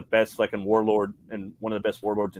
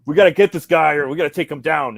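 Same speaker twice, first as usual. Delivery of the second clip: higher, louder gung-ho knight call; rally the men, loud and cocky; ballsy knight; 4 semitones higher, 6 dB louder than usual. Retold or imitated. Knight rallying his men, loud ballsy call. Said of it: "he's like".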